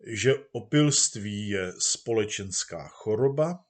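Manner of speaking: ordinary delivery, nothing marked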